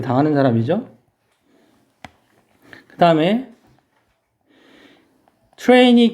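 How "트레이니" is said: 트레이니 said as English "trainee"; teacher reading 트레이니 aloud; English word said slowly. Shown as a demonstration. Teacher announces the word to the class, clearly. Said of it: "교육하다".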